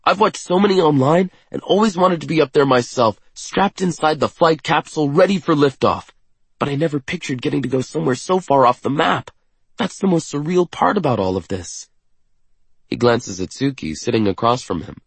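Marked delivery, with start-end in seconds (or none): 0.0-11.9 high, eager and quick; excited. A narrator's reading. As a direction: gush with wonder. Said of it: "his face lighting up".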